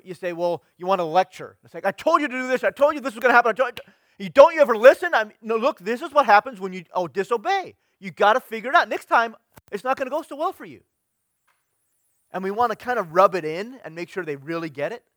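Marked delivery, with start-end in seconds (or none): 0.3-10.8 loud, high, hammered lecture; lecturing parent. Retold or imitated. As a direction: scold like a fed-up parent, much higher and louder. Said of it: "maybe as a parent, you say".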